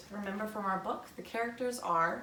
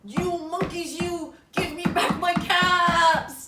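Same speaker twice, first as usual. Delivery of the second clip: higher, slow stamping demand; angry peddler; 8 semitones higher, 9 dB louder, slower than usual. Acted. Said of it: "stamp one foot".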